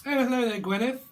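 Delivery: high, loud sing-song hello; hammed-up camp greeting. Little Britain greeting to Gwyneth, high loud camp hello. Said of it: "Little Britain".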